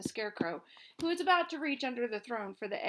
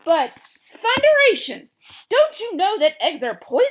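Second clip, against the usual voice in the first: much higher, much louder terrified roar; 10 semitones higher, 18 dB louder than usual. A narrator's reading. Gnome King roaring in terror about poison eggs. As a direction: roar it in panic. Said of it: "roared the king".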